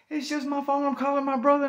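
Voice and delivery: high-pitched acted explanation; re-enacted frantic high voice; panicked pleading. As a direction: plead with someone aiming a gun. Pleads with officer aiming gun.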